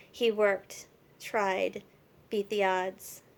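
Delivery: emphatic, measured poem reading; poem recitation. Acted. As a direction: recite in short, punched phrases with pauses. Reads her found poem aloud.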